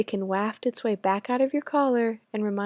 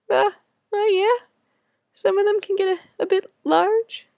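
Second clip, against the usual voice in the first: high, louder nervous stammer; awkward reply; 10 semitones higher, 6 dB louder than usual. Retold or imitated. Answering the woman's remark about Americans, in a high nervous stammer. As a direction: squirm out an answer. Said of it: "I manage back".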